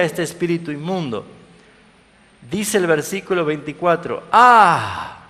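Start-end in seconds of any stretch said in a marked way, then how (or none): 4.3-5.2 high, loud demonic "Ah"; demonic cry. Shown as a demonstration. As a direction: let out a possessed man's cry. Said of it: "ese "ah" mío no es de un endemoniado".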